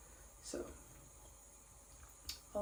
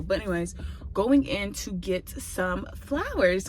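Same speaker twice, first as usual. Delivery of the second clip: ordinary delivery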